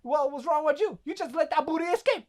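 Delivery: hammed-up high-pitched scolding; mock outrage. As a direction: imitate an exasperated rant, rushing the end. Acted Booty Warrior scolding inmates back.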